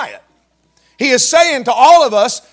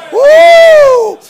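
high, loud joyful whoop; exultant whoop; 10 dB louder than usual. Real, not acted. Preacher whooping at the congregation in joy. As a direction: let out a holler of joy.

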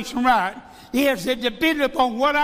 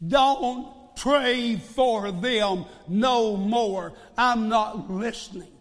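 voice of God; 2 semitones lower, much slower than usual. Acted. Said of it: "what God continually told him".

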